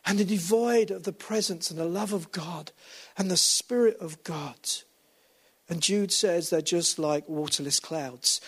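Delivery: ordinary talk, nothing marked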